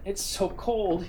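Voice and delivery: slow, halting shivering words; stammering. Acted. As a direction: stammer it out, frozen. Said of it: "he stammered".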